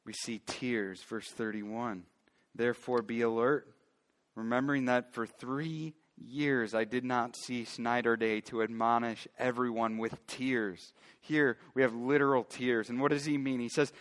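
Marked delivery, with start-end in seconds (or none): 2.5-10.9 low, quieter bible reading voice; scripture reading. A narrator's reading. Pastor reading Acts to his church, hushed and steady. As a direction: reads the verse to the congregation, soft and measured.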